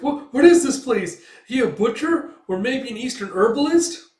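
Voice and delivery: high, loud anxious chatter; a tiny creature gawking; squeaky nervous voice. Retold or imitated. Companion gawking at the strange room, high nervous chatter. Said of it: "He's like".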